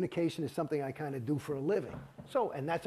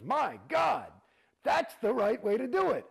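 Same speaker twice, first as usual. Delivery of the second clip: a much higher, louder shouted "My God"; L R H exclaiming; 9 semitones higher, 4 dB louder than usual. Retold or imitated. Retelling the taped founder answering "Off with your head", shouting. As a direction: belt out the astonished reaction. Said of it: "L R H on tape saying".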